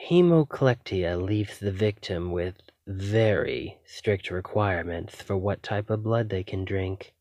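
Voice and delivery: ordinary talk, nothing marked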